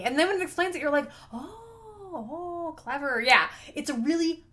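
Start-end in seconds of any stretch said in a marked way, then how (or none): 1.3-3.2 dawning realization. Acted. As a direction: act out the penny quietly dropping. Acting reader's dawning realization.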